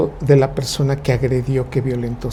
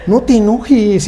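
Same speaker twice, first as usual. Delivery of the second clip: mock scolding; 6 semitones higher, 3 dB louder, slower than usual.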